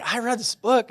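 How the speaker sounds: excited blurt. Retold or imitated. Excited report to his wife.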